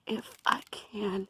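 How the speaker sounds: normal talking voice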